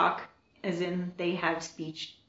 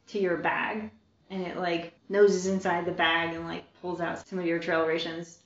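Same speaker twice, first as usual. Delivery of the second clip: ordinary talk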